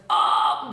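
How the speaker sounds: pure noise scream, no pitch; loud toneless rasp; pitchless distorted "ah". Shown as a demonstration. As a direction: complete vocal distortion. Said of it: "complete distortion, pitchless".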